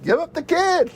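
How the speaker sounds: very high mock cry; high, hammed-up demand; Jordan. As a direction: exaggerate the co-host's advice in a squeal. Mock quote of co-host Jordan.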